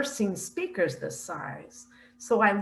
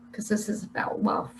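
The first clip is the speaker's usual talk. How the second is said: hushed, tearful-soft whisper; emotional whisper; 6 dB quieter than usual. Real, not acted. Moved speaker telling listeners to set hatred aside, emotional whisper.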